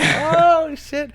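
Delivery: very high, loud "oh" then a dropped "shit"; mock surprised yelp. Imitating Bryce's shocked reaction to the name drop.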